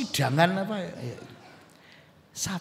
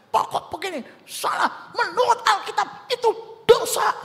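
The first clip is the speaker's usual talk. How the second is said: preachy parent; 13 semitones higher than usual. Acted. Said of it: "malah khotbah".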